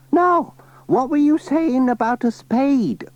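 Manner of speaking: put-on high old lady voice; chatty old granny, pitched high. Granny Dryden asking Pat about the spade, high old voice.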